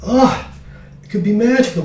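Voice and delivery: ordinary talk, nothing marked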